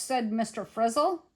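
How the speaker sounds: ordinary delivery, nothing marked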